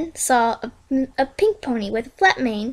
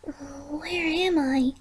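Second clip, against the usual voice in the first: groggy murmur; 2 semitones higher, 10 dB quieter, much slower than usual. Acted. Waking up groggy.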